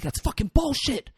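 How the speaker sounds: high-pitched mock girlfriend outrage; imitated yelling. Girlfriend argues back at Hugo, imitated yelling. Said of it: "his girlfriend is yelling at him".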